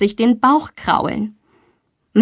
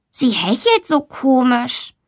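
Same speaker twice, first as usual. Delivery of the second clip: slow, drawn-out pupil's voice; a child slowly reporting something odd to the teacher; drawn-out child character voice; schoolboy Max; 3 semitones higher than usual. A narrator's reading. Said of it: "sagt Max".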